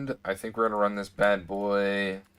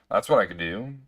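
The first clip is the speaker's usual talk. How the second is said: ordinary talk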